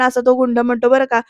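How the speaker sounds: usual speaking voice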